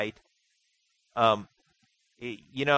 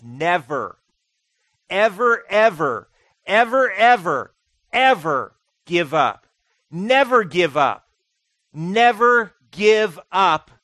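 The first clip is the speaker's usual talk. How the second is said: loud, higher, slow never ever give up; Churchill shout; 4 semitones higher, 10 dB louder, slower than usual. Retold or imitated. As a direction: thunder it like a wartime leader. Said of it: "And he said this".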